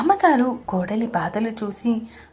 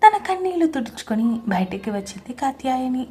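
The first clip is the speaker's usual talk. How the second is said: usual speaking voice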